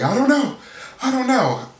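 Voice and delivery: hammed-up frantic Renee, breaking high; mock panic. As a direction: imitate her panicking, voice shooting up. Renee freaking out on the phone.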